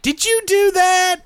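very high, slow mock shriek; imitated screaming question. Imitating startled partner accusing the teller, mock scream. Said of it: "what the hell you're screaming about".

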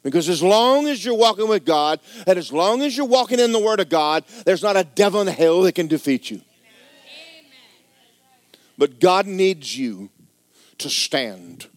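loud, high, driving sermon voice; climbing shout of a revival preacher; fiery preaching. Acted. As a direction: preach it hard and build to a peak. Preaching at full force.

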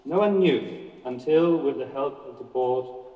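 ordinary delivery, nothing marked

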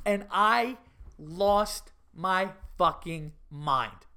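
ordinary talk, nothing marked

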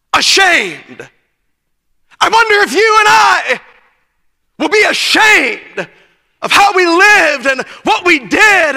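fiery youth preacher; full-force preaching. Real, not acted. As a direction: preach it out to the crowd.

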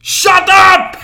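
sudden high-pitched yell at full volume; explosive shout. Retold or imitated. Fed-up clerk silencing a drunk woman, explosive shout. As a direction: blow up at a customer.

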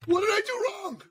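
very high, squeaky panicked voice; hammed-up high-pitched worry; mock panic. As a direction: squeal it like a man in comic alarm. Mock panic at woman crying.

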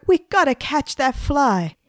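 high, urgent storybook shout; mild shout. A narrator's reading. Margaret rallying them to catch the fly, mild shout. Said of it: "shouted Margaret".

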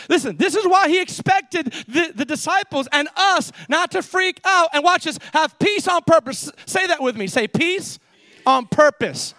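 high, driving pulpit voice; forceful preaching. Real, not acted. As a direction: preach it urgently to the congregation.

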